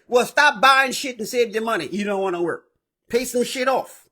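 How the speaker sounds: ordinary talk, nothing marked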